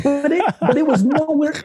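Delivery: chuckling as the thought trails off; laughing. Real, not acted. Telling the host about a big client, laughing.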